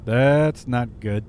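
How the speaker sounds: slow, low cool-cat captain. Retold or imitated. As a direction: draw it out low and slow, imitating an unfazed captain. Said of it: "this low voice, he goes".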